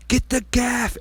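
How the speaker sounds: high-pitched mock holler; urgent retold call. Angler calling to crew mid-fight, high urgent call.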